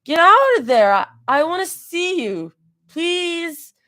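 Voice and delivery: high, pleading tipsy whine; drunk whine. A narrator's reading. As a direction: beg like a tipsy friend. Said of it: "she whined softly".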